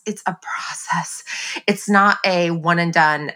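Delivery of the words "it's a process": soft whispered aside. Real, not acted. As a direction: drop to a whisper for this.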